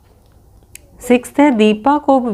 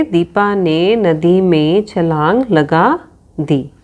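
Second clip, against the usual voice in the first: slow, each word set apart; slow dictation; slower than usual. Shown as a demonstration. Teacher dictating the reordered sentence to the children, slowly. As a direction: spell the sentence out slowly for writing. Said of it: "इस तरीके से हम लिख देंगे".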